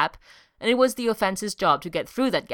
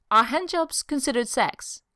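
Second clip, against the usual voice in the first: higher, sudden blurt; 7 semitones higher than usual. A narrator's reading. Tony, just woken, blurting it at Steve. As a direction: spill the question out before thinking. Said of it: "and blurted".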